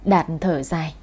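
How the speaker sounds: usual speaking voice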